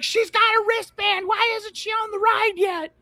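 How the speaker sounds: high, loud shrill protest; hammed-up screeching demand; mock yelling Karen. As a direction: imitate an indignant mother hollering at the operator. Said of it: "she's yelling at this guy".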